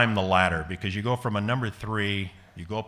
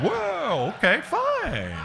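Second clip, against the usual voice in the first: much higher, slow startled backing off; startled whoa; 3 dB louder, much slower than usual. Retold or imitated. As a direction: jump back and give in, high and slow. Startled reaction closing the door.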